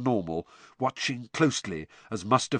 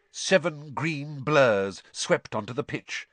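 plain reading voice

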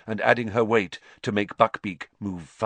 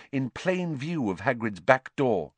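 plain reading voice

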